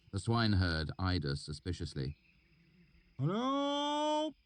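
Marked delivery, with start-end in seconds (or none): 3.2-4.3 high call across the stream; shouted greeting. A narrator's reading. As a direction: call out to strangers. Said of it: "he shouted".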